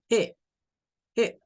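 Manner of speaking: first syllable of hello, "G", said as "heh". Shown as a demonstration. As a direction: say "G" as an H with a clear E. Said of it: "se pronuncia más como la H y la E".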